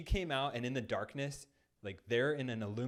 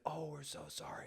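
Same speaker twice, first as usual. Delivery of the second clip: apologetic friend; 6 semitones higher, 8 dB quieter than usual. Retold or imitated. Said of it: "He's like".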